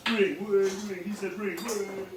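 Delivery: high sing-song phone ring; playful ringing noise. Pretend.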